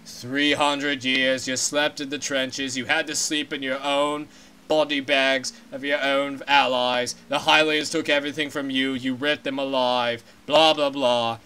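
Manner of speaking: a deep and solemn giant fish king; stately Zora king. Acted. King answers the elder.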